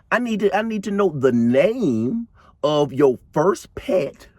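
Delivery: high, coaxing, sweet-talking voice; voicing Alice the scammer. Alice talking Beverly into giving up her details in a high, coaxing voice. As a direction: lure her in, sugary and pushy. Alice coaxes personal data.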